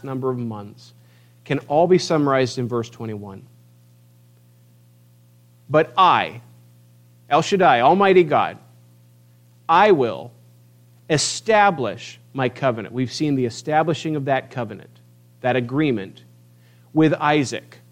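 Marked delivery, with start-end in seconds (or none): 5.7-12.9 booming proclamation. Acted. Preacher voicing El Shaddai to the congregation, booming. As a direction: proclaim it like the Almighty.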